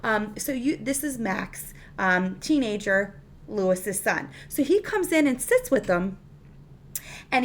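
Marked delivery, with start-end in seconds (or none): none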